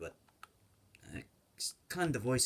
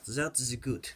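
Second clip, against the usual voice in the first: ordinary talk, nothing marked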